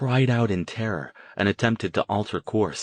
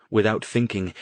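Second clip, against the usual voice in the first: usual speaking voice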